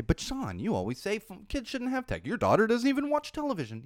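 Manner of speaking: high objecting viewer voice; imitating a viewer. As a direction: raise the pitch like a nagging viewer. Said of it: "You say".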